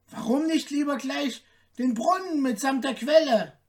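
high, cocky tailor voice; little show-off piping up; boastful tailor. A narrator's reading. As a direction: brag it as a high-voiced question. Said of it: "fragte der Prahlhans".

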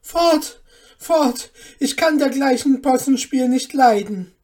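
high, very loud orders to be gone; horrified, angry cry. A narrator's reading. Mother chasing away the dwarf, loud outraged cry. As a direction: drive them off at full voice. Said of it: "rief sie".

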